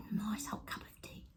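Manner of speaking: a soft breathy aside over the tea; savouring tea in a hushed whisper. Real, not acted. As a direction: whisper it to yourself. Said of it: "unvoiced whisper".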